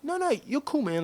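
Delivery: high-pitched retold backpedal; placating rival. Rival trying to befriend the blocker, placating high voice. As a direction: lift it high on "No, no". Retelling rival turning friendly.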